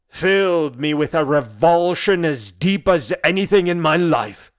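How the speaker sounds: loud, rising declaration of disgust; impassioned revulsion. Acted. Declares revulsion at sister's marriage.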